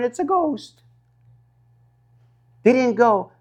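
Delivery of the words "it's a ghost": high, softer fearful cry; a hushed, scared high gasp of it; mock frightened cry. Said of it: "and they're crying".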